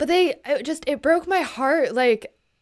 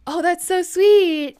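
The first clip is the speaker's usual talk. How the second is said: higher, louder, drawn-out coo; mock gushing thanks; 7 semitones higher, 6 dB louder, slower than usual. Streamers brushing off a gift with thanks, mock gushing. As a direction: imitate a gushing thank-you, stretched slow. Mimics viewers' brief thanks.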